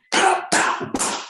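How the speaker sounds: sharp high "pow" slap noises; explosive, voiceless pow; imitated slap. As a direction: punch out mouth-made smack sounds. Imitates the slaps.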